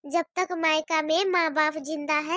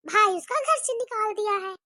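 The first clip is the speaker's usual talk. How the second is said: ordinary talk, nothing marked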